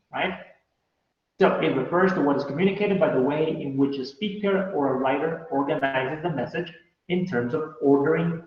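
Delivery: usual speaking voice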